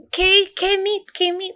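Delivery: high, slow, halting K meat; clumsy customer trying Spanish; imitating customers mangling carne; mock broken Spanish. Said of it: "And they're like".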